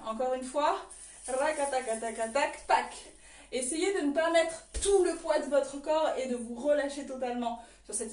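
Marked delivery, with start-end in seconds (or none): 1.3-2.9 rapid "rakataka" rattle, then a dry "pac"; rhythmic vocal drumroll. Shown as a demonstration. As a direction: sound out the trembling, end on a sharp hit. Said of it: "ce qui va donner".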